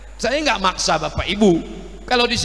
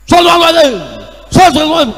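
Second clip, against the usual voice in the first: high, loud barked blessing; mock recording of a bellowed salawat; angry salawat; 6 semitones higher, 7 dB louder, much faster than usual. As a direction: shout it out as if furious. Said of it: "Belum pernah saya dengar solawat marah-marah".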